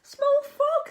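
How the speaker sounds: dog answering the little frog; kindly dog. Acted. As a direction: greet the frog warmly as the dog. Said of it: "said the dog".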